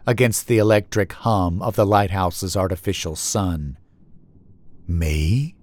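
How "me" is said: lightly voiced low, hazy entity; soft, hazy voice. A narrator's reading. Stick Man's first word from the lighthouse, soft low hazy voice. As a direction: answer softly, low and hazy. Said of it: "this one soft, hazy, almost inaudible".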